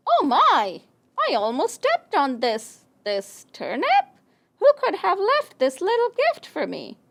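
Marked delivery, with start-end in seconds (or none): 0.1-3.9 surprise tailing into puzzled discovery; startled dog. Acted. Dog nearly steps on turnip.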